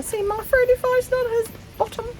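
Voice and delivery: way up high, playful squeak; high squeaky joke. Pretend. Joking to viewers to look at her, not the dog, high and playful.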